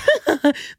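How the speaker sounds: quick high laugh; bright laugh. Real, not acted. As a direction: laugh at her own slip. Laughs after correcting herself.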